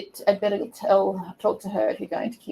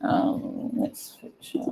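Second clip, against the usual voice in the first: ordinary delivery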